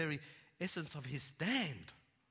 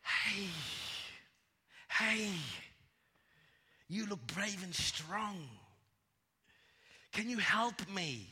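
slow, higher-pitched snake calling out; pleading snake; 4 semitones higher, slower than usual. Acted. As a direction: draw it out, asking the boy for help. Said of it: "And the snake says".

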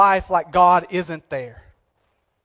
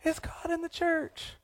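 distant call; 10 semitones higher, 12 dB quieter than usual. Retold or imitated. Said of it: "hearing somebody yelling".

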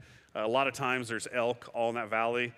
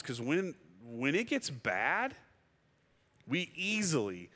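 normal talking voice

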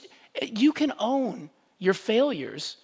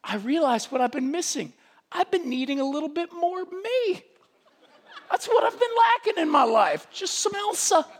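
a hint of a high, self-adoring Elsa; lightly voiced pop princess, mocking; 10 semitones higher than usual. Preacher mocking Elsa's self-love, lightly voiced high. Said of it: "She's going".

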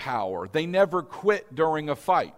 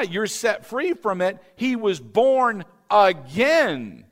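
loud, high, fervent preaching; preaching at full force; 4 semitones higher, 6 dB louder than usual. Acted. Pastor preaching about Christ's victory over death, loud and fervent. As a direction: build the sermon to a fervent peak.

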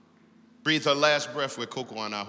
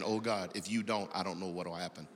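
desperate hushed prayer; 5 semitones lower, 9 dB quieter than usual. Retold or imitated.

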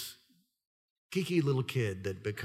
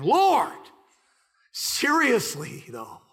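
pleading cry; 9 semitones higher, 4 dB louder, much slower than usual. Retold or imitated. Pleading with God in the ocean.

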